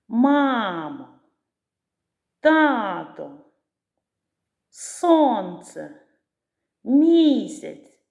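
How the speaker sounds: stressed syllable of "мама", "тато", "сонце", "місяць" hit hard and drawn out; наголошений склад із силою. Shown as a demonstration. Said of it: "я вимовляю слово із певною силою". (із силою виділити наголос у словах «мама», «тато», «сонце», «місяць»)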